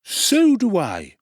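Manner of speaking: high, slow, fawning undertaker voice; high ingratiating tradesman; oily undertaker. Acted.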